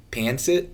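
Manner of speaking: pancit with a flat "a" as in pan, rising; anglicized pronunciation. Shown as a demonstration. Host checking the word with co-host, pancit mispronounced as PAN-sit. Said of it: "it's pancit".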